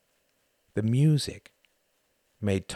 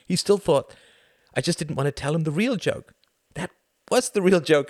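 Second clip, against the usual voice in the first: insistent; 8 semitones higher, 7 dB louder than usual. A narrator's reading. Tom's reply in raised voice.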